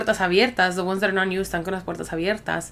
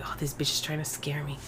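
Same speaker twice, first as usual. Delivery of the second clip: muttered thought; 4 semitones lower, 12 dB quieter than usual. Retold or imitated. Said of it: "And I was like".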